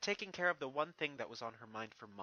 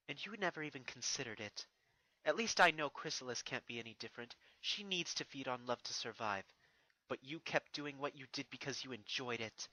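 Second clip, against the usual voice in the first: regal princess voice. Acted. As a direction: speak down to a defeated foe, proud and composed. Narrator voices Celestia.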